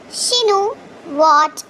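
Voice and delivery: ordinary talk, nothing marked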